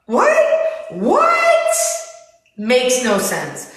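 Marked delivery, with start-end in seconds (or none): 0.1-1.7 much higher, squeaky what; a high, baffled yelp of what; incredulous squeal. Real, not acted. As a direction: shriek it in disbelief. Baffled by daughter crying.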